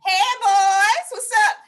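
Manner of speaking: high, loud come-on; high, loud playful flirting; flirty boob voice. Retold or imitated. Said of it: "boob be saying".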